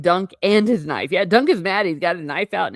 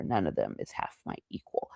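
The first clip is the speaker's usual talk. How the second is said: none of them is half my equal whispered, much softer; 13 semitones lower, 11 dB quieter than usual. Shown as a demonstration. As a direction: breathe the line as a secret aside. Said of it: "But, rather".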